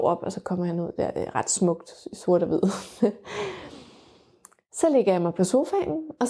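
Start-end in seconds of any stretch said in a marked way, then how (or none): 2.7-3.6 a short, high, real laugh; a quick happy laugh, high up. Real, not acted. Laughs at the birth photo.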